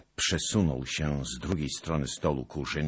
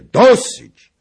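very high, very loud scream; furious shout; 20 dB louder than usual. Acted. Bohun cutting off the reading, a furious shout. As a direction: explode with rage. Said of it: "krzyknął nagle Bohun".

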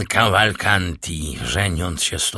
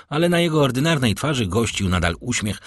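plain reading voice

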